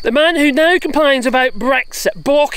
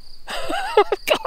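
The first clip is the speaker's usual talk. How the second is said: high laugh; 6 dB quieter than usual. Real, not acted. Laughing at Brexit madness.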